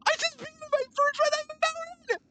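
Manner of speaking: excited. Real, not acted. Still elated after win.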